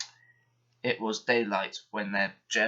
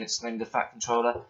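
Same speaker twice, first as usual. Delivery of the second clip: ordinary delivery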